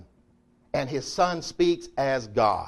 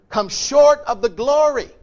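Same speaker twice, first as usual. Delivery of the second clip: preaching the key verse; 12 semitones higher, 9 dB louder than usual. Acted.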